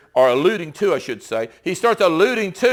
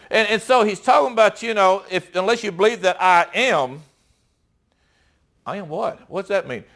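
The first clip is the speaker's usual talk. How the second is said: ordinary talk, nothing marked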